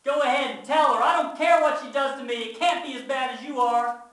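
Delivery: loud, high defiant shout; yell out. A narrator's reading. Child dares brother to tell Mama, defiant yell. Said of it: "And I yell out".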